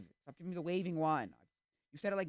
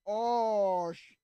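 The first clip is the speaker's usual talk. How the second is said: acted dismay; 7 semitones higher, 11 dB louder than usual. Acted realisation of awkward mistake.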